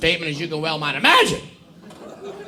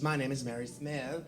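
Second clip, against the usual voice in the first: put-on softer, lower prim little-girl recital; prim student; 6 semitones lower, 17 dB quieter than usual. A prim A student reciting her name to the teacher.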